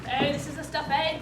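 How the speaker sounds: ordinary talk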